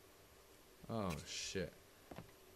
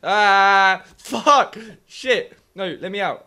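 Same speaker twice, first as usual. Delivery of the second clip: loud, high panicked yelling; frantic shouting to escape; 5 semitones higher, 12 dB louder, slower than usual. Real, not acted. Player trapped in a scary room, panicked yell.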